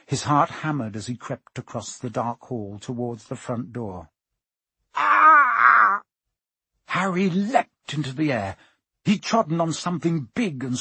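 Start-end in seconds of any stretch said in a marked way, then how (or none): 4.9-6.0 high, loud scream; sudden full-voiced yell of shock; startled scream. A narrator's reading. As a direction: scream out in fright. Harry treads on something alive.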